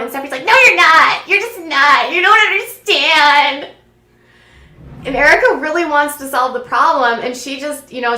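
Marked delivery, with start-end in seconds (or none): 0.5-3.7 high, loud mock protest; mock angry retort. Girlfriend rejects partner's efforts to work it out, mock petulant outburst. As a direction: imitate a petulant denial, drawn out at the end.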